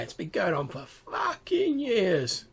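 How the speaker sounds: high, dragged-out goblin grumble; exasperated goblin. Acted. Goblin fed up with endless food patrols, exasperated. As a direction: draw the complaint out slowly and high.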